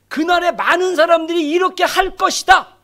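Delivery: emphatic scripture. Acted.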